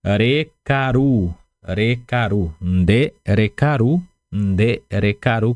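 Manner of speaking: "recaru" and "nde recaru" spoken very slowly, syllable by syllable; teacher drawing out each syllable of "recaru"; careful slow model for students to repeat; slow pronunciation demo. Shown as a demonstration. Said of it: "Então, você falaria".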